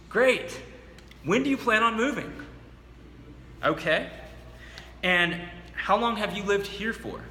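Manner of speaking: grin and sell it at the door; high enthusiasm. Shown as a demonstration. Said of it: "say it with high enthusiasm".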